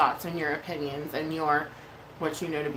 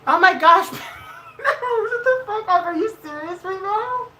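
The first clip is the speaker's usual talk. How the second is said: shocked shriek; 12 semitones higher, 6 dB louder than usual. Real, not acted. Shocked screaming at a big donation.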